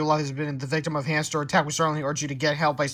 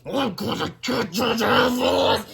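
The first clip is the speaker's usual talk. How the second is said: slow, strained, raised threat; angry scream; 6 semitones higher, 4 dB louder, much slower than usual. A narrator's reading. Principal bursting out of the restroom, screaming. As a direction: threaten them in a drawn-out yell. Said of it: "Mister Krupp screamed".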